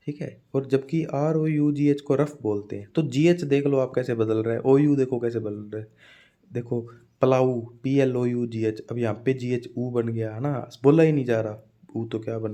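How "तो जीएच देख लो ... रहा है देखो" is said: normal talking voice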